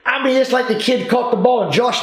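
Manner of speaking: normal talking voice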